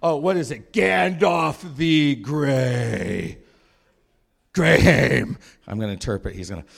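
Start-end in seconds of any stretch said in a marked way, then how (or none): 0.0-5.4 loud cackling laugh, then a sneer; mad laughing king. Retold or imitated. Possessed king Theoden mocking Gandalf, in a loud laughing sneer. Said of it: "Theoden starts laughing".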